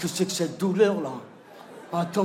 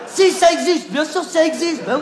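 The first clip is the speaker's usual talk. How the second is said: wife snaps back much higher and louder; the wife insists the fruit exists, high and loud; insisting wife; 9 semitones higher, 8 dB louder than usual. Acted. To her husband, the wife snaps back, much higher and louder.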